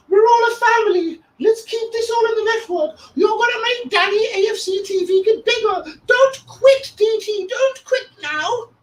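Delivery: very high squeaky mocking voice; mock pleading fan. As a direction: whine it like a desperate fanboy.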